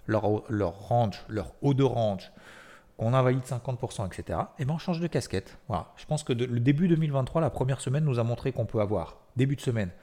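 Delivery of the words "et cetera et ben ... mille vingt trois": ordinary talk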